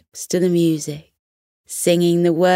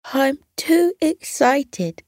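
much higher, slow, fizzing excitement; too excited; 8 semitones higher, slower than usual. A narrator's reading. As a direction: gush with nervous joy. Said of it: "she said".

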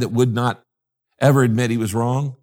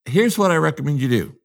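ordinary talk, nothing marked

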